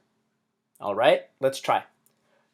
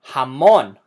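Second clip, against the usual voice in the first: "Jamón" loudly as ha-MON; "Jamón" with a strong stressed ending; stress on last syllable; 8 dB louder than usual. Shown as a demonstration. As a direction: punch the -món of "Jamón". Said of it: "We emphasize the last syllable".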